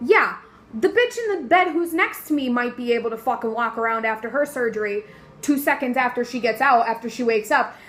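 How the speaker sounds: ordinary delivery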